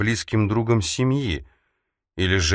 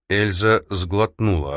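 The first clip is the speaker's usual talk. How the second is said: usual speaking voice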